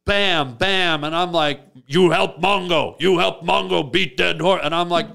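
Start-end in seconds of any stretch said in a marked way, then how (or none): 1.9-4.6 pushy caveman demand; a brute bossing his friend; caveman talk. Retold or imitated. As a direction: caveman Mongo insisting on help. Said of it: "he still talks like this".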